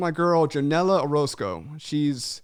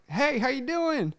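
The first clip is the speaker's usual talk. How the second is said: higher, slow friendly cashier greeting; imitating cashier; 9 semitones higher, slower than usual. Host retelling the drive-thru lady greeting him, slow higher mimicry. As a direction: mimic the drive-thru lady's cheery hello. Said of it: "she's like".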